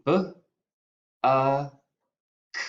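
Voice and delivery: "B, a, k" as buh, uh, k with long gaps; sounded out. Shown as a demonstration. Teacher spells the first syllable buck, sounding out B, a, k separately. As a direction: sound out B, a, k one at a time. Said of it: "My first syllable is buck".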